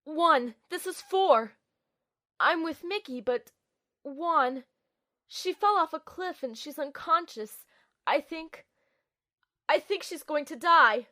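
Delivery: high, urgent and frightened; panicked radio report. Acted. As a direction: report in rising panic over the comm.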